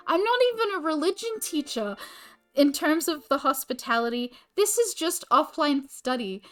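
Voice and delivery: high, loud and indignant; a real, worked-up complaint about the new classes; exasperated rant. Real, not acted. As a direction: vent it, pitch up and push. Ranting about the timetable change.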